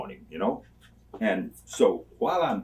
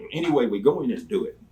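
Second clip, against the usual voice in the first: ordinary delivery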